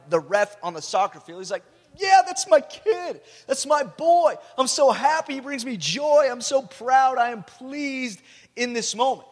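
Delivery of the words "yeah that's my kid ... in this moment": much higher and louder joyful cheering, slow at first; proud excited dad. Retold or imitated. God cheering for his son like the ref, excited. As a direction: burst out with fatherly pride. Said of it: "He's freaking out like the ref".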